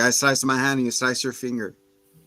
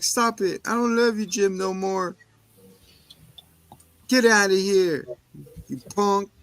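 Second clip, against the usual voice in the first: higher mock-angry voice; mock breakup; 7 semitones higher than usual. Girl telling her boyfriend off, higher mock anger. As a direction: dump him with hammed-up anger. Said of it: "He's like".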